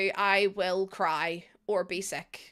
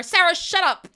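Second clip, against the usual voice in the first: loud, raised outburst; playful scolding; 6 semitones higher, 9 dB louder than usual. Real, not acted. Streamer telling off a chatter, playful loud scolding. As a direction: cut someone off with a sudden yell.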